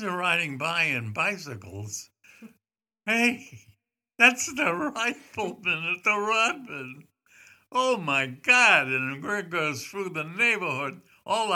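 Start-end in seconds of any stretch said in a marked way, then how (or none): 3.1-8.9 slow, louder gasp of amazement; drawn-out starstruck oh my God; awed exclamation. Retold or imitated. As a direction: let it out like a starstruck neighbour. Kids amazed at the rifleman.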